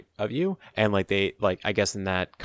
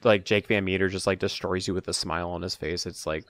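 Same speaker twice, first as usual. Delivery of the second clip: usual speaking voice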